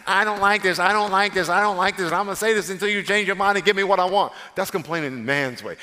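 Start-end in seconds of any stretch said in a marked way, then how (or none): 0.1-4.3 put-on loud, high whiny child voice; childish whining. Shown as a demonstration. Preacher mocking a child's complaint to God, loud high whine. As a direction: whine like a spoilt kid demanding. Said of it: "complaining a child's way".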